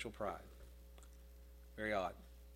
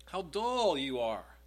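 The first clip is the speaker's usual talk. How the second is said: exasperated rebuke; 2 semitones higher, 4 dB louder, slower than usual. Acted. Exasperated Jesus rebukes disciples.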